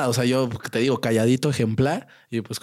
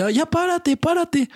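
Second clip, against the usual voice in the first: much higher strained mock yell; bully shouting; slower than usual. Bully demanding a fight, hammed-up yell. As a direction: order him to stand up. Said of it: "y me dice".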